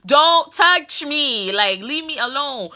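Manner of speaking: loud, slow don't-touch-me; slow loud warning. Retold or imitated. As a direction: push him off with a slow firm yell. Shouting him away.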